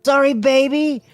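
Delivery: high, loud yell; yelled over shoulder. A narrator's reading. Bart yelling to Eloise while pushing away. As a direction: shout it back over the crowd. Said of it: "he yelled over her shoulder".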